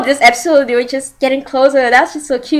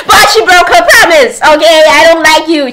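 raised, higher-pitched mock indignation; playful outrage; 6 semitones higher, 4 dB louder than usual. Fan scolds the heroine for breaking her promise, high mock outrage.

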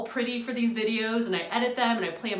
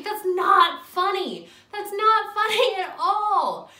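high, loud, slow insistence; stern anger; 10 semitones higher, 6 dB louder, slower than usual. Real, not acted. Telling off the joker, slow stern anger. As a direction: lay it down firmly, not amused. Angry at the joke.

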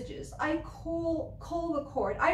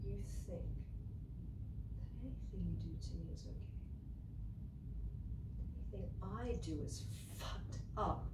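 quiet bitterness; 5 semitones lower, 15 dB quieter than usual. Acted. Bitter accusation dropping quiet.

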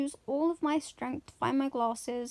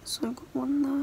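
hushed whisper, mum asleep nearby. Real, not acted. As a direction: keep it to a whisper so nobody wakes. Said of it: "I have to whisper 'cause my mum's asleep".

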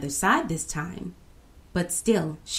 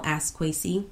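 ordinary delivery, nothing marked

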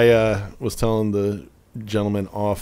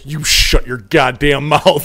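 mock threat; 3 semitones higher, 5 dB louder, slower than usual. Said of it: "where I replied".

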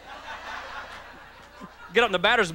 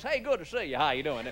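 smooth salesman; 3 semitones lower, 6 dB quieter than usual. Retold or imitated. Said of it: "guys that talk like this".

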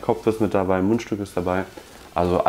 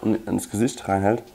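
tin held over mouth; sniffing tin while talking; speaking into a tin; slower than usual. Real, not acted. Seen 0: From 2.9 s he holds the open tin over his nose and mouth.

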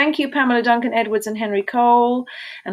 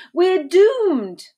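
high, loud wail of doom; hammed-up panic, pitched up; despairing cry; 11 semitones higher, 5 dB louder than usual. A narrator's reading. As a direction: cry out that all is lost. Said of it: "they cried".

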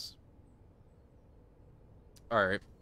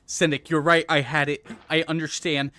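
usual speaking voice

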